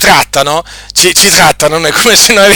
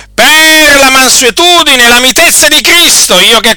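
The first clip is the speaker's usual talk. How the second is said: higher, louder fervent preaching; preaching at full force; 9 semitones higher, 5 dB louder than usual. Acted. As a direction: thunder the thanksgiving from the pulpit. Reading scripture with fervour. (predica infervorata, più alta e più forte)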